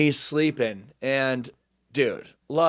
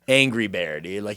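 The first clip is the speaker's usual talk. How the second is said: ordinary talk, nothing marked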